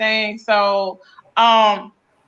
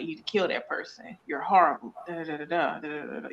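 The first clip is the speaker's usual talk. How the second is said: mock-menacing voices, much softer, fading down; hushed voices in the head; 6 semitones lower, 10 dB quieter than usual. Explaining schizophrenic voices, quietly imitating the dark voice. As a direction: murmur "You need to kill that person" as a dark voice in the head, trailing into "da, da, da". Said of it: "what they hearing is paranoid".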